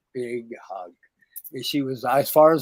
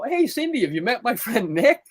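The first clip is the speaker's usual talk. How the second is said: much higher, playful hey Cindy; sly introduction; 8 semitones higher than usual. Retold or imitated. Scene: teller introduces the producer to Cindy, playfully and much higher. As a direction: bait her with a cheerful introduction.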